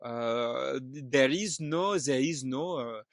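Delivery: put-on halting, heavily French-accented English. Telling what my son said to Papa, in a put-on French accent. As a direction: put on a French accent, drawn out with many uhs. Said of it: "exactly what I did now".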